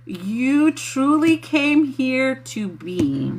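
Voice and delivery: deliberate and drawn-out, like relaying a dictated message; word for word. Real, not acted. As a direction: spell it out slowly, word by word. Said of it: "they want me to say it word for word".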